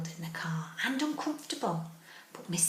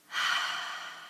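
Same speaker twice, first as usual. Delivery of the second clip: contented sigh. Acted. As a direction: let out a sigh of relief. Bear finally settles into bed.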